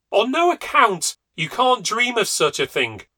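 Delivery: very high, loud alarmed protest; exclaimed. A narrator's reading. Wife protesting against the otter hounds, high loud alarm. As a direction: stop him in panic. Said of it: "exclaimed Amanda".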